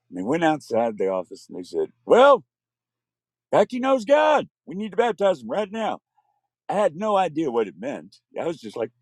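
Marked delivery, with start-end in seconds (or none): 2.1-6.0 high, loud eager proclamation; excited preacher. Retold or imitated. Pastor telling the church, high and excited. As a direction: announce it like joyful news.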